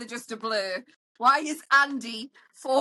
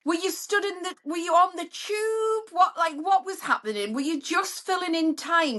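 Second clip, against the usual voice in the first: incredulous quizzing; 8 semitones higher than usual. Retold or imitated. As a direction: grill the match in a raised, baffled voice. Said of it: "I'm kinda like".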